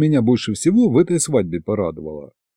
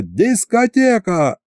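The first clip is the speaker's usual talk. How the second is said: yelled announcement; 8 semitones higher, slower than usual. A narrator's reading. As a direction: call the party open on a raised pitch. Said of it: "заорал я". (праздничный выкрик ведущего)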